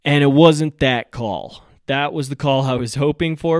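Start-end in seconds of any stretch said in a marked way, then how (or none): 0.0-1.6 "wasn't" hit loud and drawn out; slow, emphatic letdown, leaning hard on "wasn't"; stress on WASN'T. Real, not acted. Angler revealing the call he didn't hope for, "wasn't" loud and stretched.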